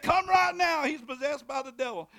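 high, loud hurried plea; urgent shout. Retold or imitated. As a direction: yell it down the phone. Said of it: "They said".

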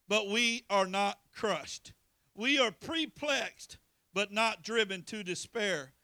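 slow, loud, punched preaching; preaching the scripture. Acted. Preacher reading scripture to church, slow and loud. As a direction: hammer each line home to the congregation.

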